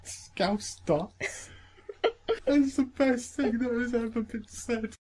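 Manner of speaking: high, squeezed-out words through laughing; cracked up, voice climbing high; helpless laughter. Real, not acted. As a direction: barely get the words out for laughing.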